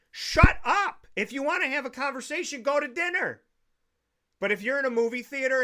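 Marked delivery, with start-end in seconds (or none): 0.1-3.4 high-pitched shout of exasperation; exasperated outburst. Acted. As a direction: burst out at noisy moviegoers, voice pitched up. Ranting at people talking during films.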